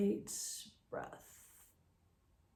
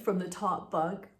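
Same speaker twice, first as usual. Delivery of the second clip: ordinary talk, nothing marked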